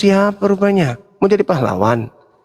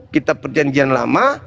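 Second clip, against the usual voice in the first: usual speaking voice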